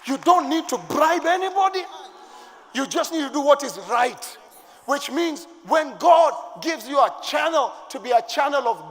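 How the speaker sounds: loud, high preaching at full force; booming pulpit delivery; fiery preaching. Real, not acted. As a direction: preach it hard to the church.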